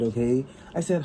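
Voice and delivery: ordinary talk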